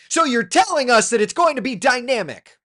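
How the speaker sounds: high, loud mock disbelief; incredulous mock quote. Mocking the AccuWeather forecast with loud disbelief. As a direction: scoff like you can't believe the forecast. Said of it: "we're like".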